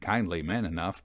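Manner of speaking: usual speaking voice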